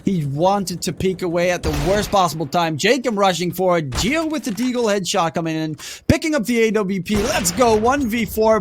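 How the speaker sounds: fast, rising live play-by-play; esports caster. Acted. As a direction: call the action as it happens.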